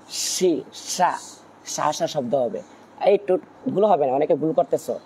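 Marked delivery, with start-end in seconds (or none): 0.1-1.2 সি, সা said "shi, sha" with hushing air; sheen's airy sound. Shown as a demonstration. Teacher demonstrates the letter's sound, hushing sh in সি, সা. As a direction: let the sh rush out in সি, সা. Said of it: "সিনের একটা আওয়াজ হবে".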